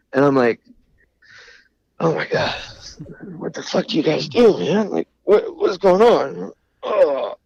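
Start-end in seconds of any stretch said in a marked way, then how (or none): 2.0-7.3 exasperated disbelief. Retold or imitated. Exasperated at lost records.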